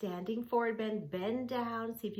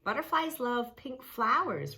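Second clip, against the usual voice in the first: put-on a higher, louder girl's voice; lightly voiced Rachel; 5 semitones higher, 5 dB louder than usual. Rachel tells Sammy about butterflies, lightly voiced girl. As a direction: tell it with delight.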